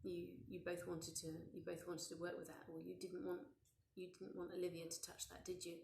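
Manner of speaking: softly spoken, offhand comfort; casually validate the child, keeping it soft; casual validation. Acted. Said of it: "very casually val-, validate it".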